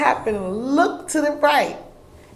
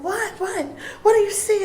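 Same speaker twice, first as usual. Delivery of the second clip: startled daughter; 12 semitones higher than usual. Retold or imitated. Daughter reacting to mother's shock.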